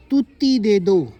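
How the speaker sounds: put-on lightly lisped child voice saying तुत्ती for छुट्टी; a hint of a little girl's baby talk, slow; mild childish lisp on तुत्ती दे दो. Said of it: "वो तोतली बोलती है ना थोड़ी".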